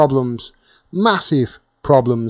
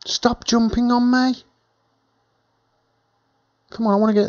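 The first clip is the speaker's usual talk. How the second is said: real telling-off; 10 semitones higher, 4 dB quieter than usual. Real, not acted. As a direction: tell a jumping pet off in a raised pitch. Telling off a jumping pet.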